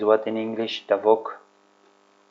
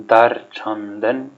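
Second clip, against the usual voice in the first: Dar chandan said slowly, each syllable drawn out; slow model phrase; 3 dB louder, much slower than usual. Shown as a demonstration. Teacher to learners, modeling a new phrase, slow and clear. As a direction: spell out Dar chandan for the class. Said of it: "slow model for learners".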